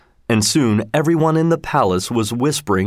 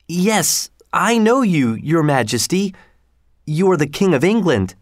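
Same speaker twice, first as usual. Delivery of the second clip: put-on higher, nervous young voice; a boy answering the king carefully; timid boy; 6 semitones higher than usual. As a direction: Tom answering his majesty.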